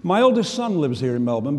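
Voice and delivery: ordinary delivery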